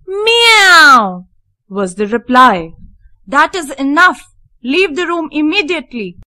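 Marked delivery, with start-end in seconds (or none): 0.1-1.2 high, loud cat's mew; kitten meow. A narrator's reading. Kitten in Heidi's pocket answers the housekeeper, high loud meow. 3.3-6.2 a higher, strangled angry order; choking with anger. A narrator's reading. Housekeeper orders Heidi out, choked with anger. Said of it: "choked Miss Rottenmeier, red with anger".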